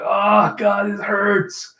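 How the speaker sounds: pained groan. Retold or imitated. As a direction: groan it out slowly as if hurting. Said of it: "just kinda laid up and like".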